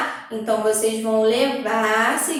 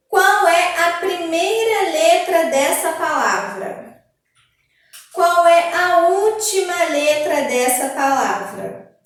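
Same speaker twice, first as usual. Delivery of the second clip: workbook read-aloud; 4 semitones higher, 4 dB louder, slower than usual. Acted. Reads workbook questions aloud.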